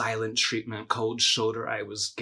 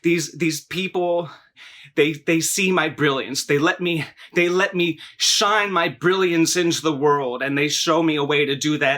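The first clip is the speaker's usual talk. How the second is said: bawling and yelling; 3 semitones higher, 5 dB louder than usual. Retold or imitated. Daughter acting out her mother's outburst, bawling and yelling. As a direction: cry out in wounded protest, as the mother. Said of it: "she's bawling and she's yelling".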